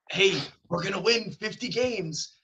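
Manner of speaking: put-on high-pitched confidence; mock optimistic boast. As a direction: voice an over-hopeful fan's boast, high and slow. Voicing a team's hopeful boast.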